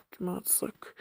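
Kime atsak whispered unvoiced. Real, not acted. Same question then whispered.

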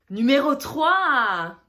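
loud, drawn-out ranking call; countdown announcement. Real, not acted. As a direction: announce the next rank with a big, stretched ending. Countdown of top five accents.

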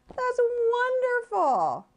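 delighted dad. Acted. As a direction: beam with pride. Daddy praising the child.

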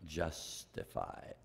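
whispered, slow justified; hushed on justified; soft slow word. Real, not acted. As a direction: let justified fall to a hush.

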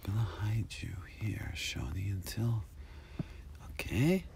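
very soft, secretive whisper; hushed whisper. Acted. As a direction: whisper as if hiding someone. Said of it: "You gotta be really quiet".

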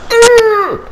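loud, high-pitched imitated straining groan; straining grunt. Retelling Jake expelling Ice King, high straining groan. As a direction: push out a comic strained "ugh".

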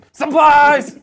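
loud, high, laughing yell; shouted punchline. Acted. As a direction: burst out with it, laughing. Janitor punchline jumping out.

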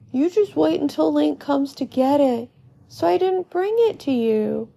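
put-on high kid voice, hurrying along; Colin, explaining. A narrator's reading. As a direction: explain eagerly to an older friend. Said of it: "Colin is standing in front of Epona".

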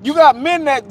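high, emphatic indignation; heated outrage. Real, not acted. Telling friends that harm to children cannot be undone, heated. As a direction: push it out high and forceful.

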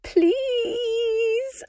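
very high, crying plea; sobbing plea. Acted. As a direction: beg through sobs.

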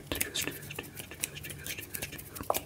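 soft, fading trigger whispers; fast aggressive asmr. Acted. Fading trigger word repetition.

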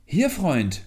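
loud, high answering call; lifted, ringing reply; calling back. A narrator's reading. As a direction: shout back from a distance. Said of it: "rief er wieder".

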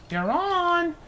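high, eager little bird voice; Missus Bird. A narrator's reading. Missus Bird answers her husband, high and eager. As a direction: chirp it out like a bossy wife bird. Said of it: "said Missus Bird".

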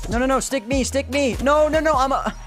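high frantic shouting no no no; panicked yelling. Real, not acted. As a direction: yell in panic as you get stabbed. Panicked yelling while getting stuck.

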